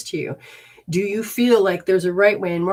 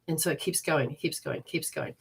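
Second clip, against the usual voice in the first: growing softer each repeat; fading away; 3 semitones lower, 8 dB quieter than usual. Real, not acted. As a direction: let it die out. Trailing repetition.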